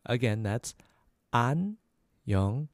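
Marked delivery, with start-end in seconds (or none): 1.3-2.7 안녕하세요 slowly, one separated syllable at a time; slow syllable breakdown. Shown as a demonstration. Teacher repeating the formal hello for learners, slow syllables. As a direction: space out every syllable of 안녕하세요. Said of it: "A little faster".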